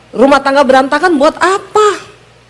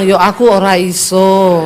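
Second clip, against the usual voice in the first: low, drawn-out Javanese admission; subdued Javanese "can't do it"; Javanese husband giving up; 6 semitones lower than usual. Retold or imitated. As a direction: imitate a Javanese man's falling tone. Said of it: "Dia bilang".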